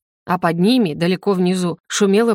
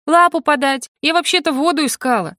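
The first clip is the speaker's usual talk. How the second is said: high, affronted comeback; indignant retort; 6 semitones higher than usual. A narrator's reading. Heroine to the bossy general, indignant retort. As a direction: protest with sarcastic indignation. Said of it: "возмутилась я".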